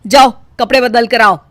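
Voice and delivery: loud, firm scolding; domineering mother-in-law lecture. Acted. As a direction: lay down the house rules.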